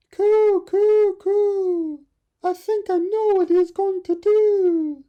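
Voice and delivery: very high, loud cooing voice; cooing dove. Acted. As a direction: coo knowingly like a dove. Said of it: "said the dove".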